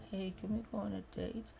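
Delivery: very soft, timid date request; small, unsure voice; unconfident ask. Shown as a demonstration. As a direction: ask meekly, barely audible. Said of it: "Show some confidence".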